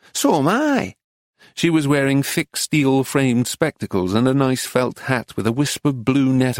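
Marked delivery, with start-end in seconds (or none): 0.1-1.0 high, delighted northern English lady; bright North Country accent. A narrator's reading. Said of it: "in an English North Country accent".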